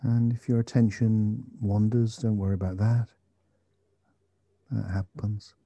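slow, calm meditation guide; guided meditation. Acted. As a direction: gently guide attention to the breath.

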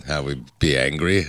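ordinary talk

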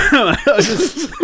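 high laughter breaking through the words; can't get the words out for laughing; laughing hard. Real, not acted. Friend laughing while explaining the dog's phone, laughing through it.

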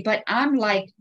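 usual speaking voice

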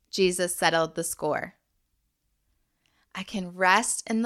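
short measured phrases with long pauses; call-and-repeat recitation, phrase by phrase; repeat-after-me declaration. Shown as a demonstration. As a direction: lead listeners through a blessing, leaving room to echo.

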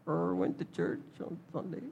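hushed, embarrassed near-whisper; sheepish mumble. Acted. Churchgoer admitting church to a coworker, hushed and sheepish. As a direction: admit it under your breath, a bit ashamed.

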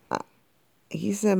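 ordinary delivery, nothing marked

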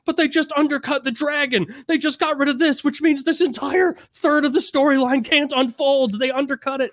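high-pitched indignant book fan rant; outraged fans. Retold or imitated. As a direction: complain like a betrayed superfan.

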